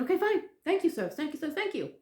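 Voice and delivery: re-enacted eager, high-pitched gratitude; hurried thanks. Hurried thanks ending the call.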